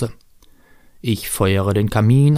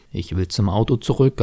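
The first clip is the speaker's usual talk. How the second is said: ordinary delivery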